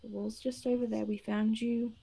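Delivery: calm, spaced-out reassurance; grounding reassurance. Acted. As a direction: soothe a panicked patient. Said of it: "just attempting to ground him".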